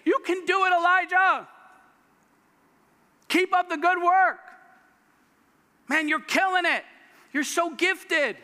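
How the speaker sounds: high, loud pep-talk cheering; mock cheer. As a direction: hype him up like a cheerleader. Imagined cheerleading God.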